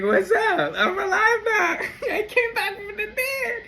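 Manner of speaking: very high, laughing playful voice; giddy falsetto with a laugh; gleeful risen Jesus. Retold or imitated. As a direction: cackle it out like a cheeky comeback. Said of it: "he was like".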